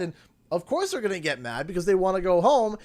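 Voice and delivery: usual speaking voice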